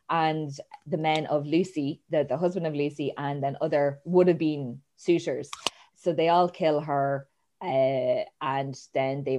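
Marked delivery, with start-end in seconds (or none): none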